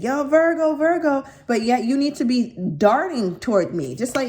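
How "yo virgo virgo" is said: mock call after someone, higher and louder; hollering Virgo's name from behind. Imitating the partner flailing and calling after Virgo, higher mock holler.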